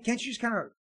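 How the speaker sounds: usual speaking voice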